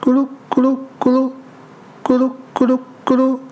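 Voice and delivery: fast, high gurgling belly growl; tummy rumble noise. Acted. Weasel shows the bear his rumbling hungry stomach, fast high gurgle. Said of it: "你听".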